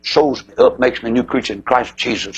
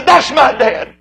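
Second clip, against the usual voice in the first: proud claim; 10 semitones higher, 4 dB louder, slower than usual. Pretend. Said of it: "if he could just say".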